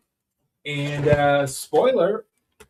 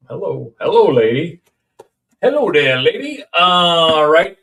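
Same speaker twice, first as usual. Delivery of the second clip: excited greeting; 5 semitones higher, 9 dB louder than usual. Acted. Greets a toy figure.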